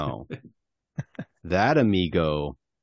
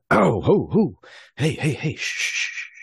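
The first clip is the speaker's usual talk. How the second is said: hushing soothe; 12 semitones higher, 5 dB louder, slower than usual. Acted. Soothing the gagged sleeper.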